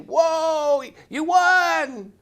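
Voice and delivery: high, loud cheering shout; excited cheer. Retold or imitated. Players at the table cheering the sergeant's win, high and loud. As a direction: cheer like the whole table just saw the winning hand.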